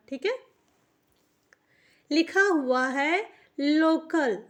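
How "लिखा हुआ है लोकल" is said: slow, stretched reading of local; slow dictation. Shown as a demonstration. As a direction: read लिखा हुआ है local slowly for the class. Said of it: "slow reading of the word". (धीरे धीरे पढ़कर बताना)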